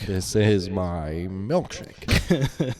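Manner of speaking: very slow, lightly voiced villain line; slow menacing Plainview. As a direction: drag it out like a lightly menacing tycoon. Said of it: "There Will Be Blood".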